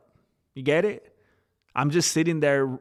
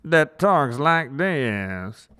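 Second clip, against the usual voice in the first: slow, louder put-on AI narrator voice; TikTok AI voice; 5 dB louder, much slower than usual. Streamer demoing the AI voice to chat, slow and put-on. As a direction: drag it out like the TikTok AI voice. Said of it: "The A I voice".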